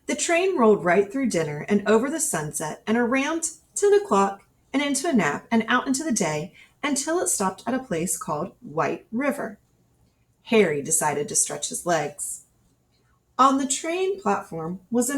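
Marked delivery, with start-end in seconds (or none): none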